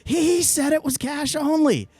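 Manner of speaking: slow, very high mock shriek; freaked-out shouting. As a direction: wail it out, imitating someone losing it. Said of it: "he just freaked out".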